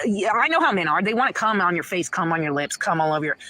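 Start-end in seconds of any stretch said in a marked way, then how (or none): none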